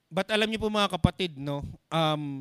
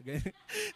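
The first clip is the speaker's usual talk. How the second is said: high laugh; 14 semitones higher, 9 dB quieter than usual. Pretend. Mock crying churchgoer bit.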